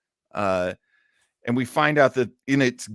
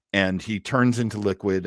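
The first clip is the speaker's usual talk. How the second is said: usual speaking voice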